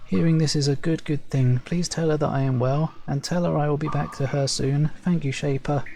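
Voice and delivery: light up at news of his mate; grateful Servile farmer. Acted.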